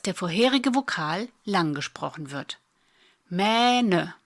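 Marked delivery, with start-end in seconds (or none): 3.3-4.1 "Mähne" with a long, held Ä; long vowel. Shown as a demonstration. Teacher demonstrating vowel length, "Mähne" with drawn-out Ä. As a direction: stretch the first vowel of "Mähne". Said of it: "Das Ä wird lang gesprochen".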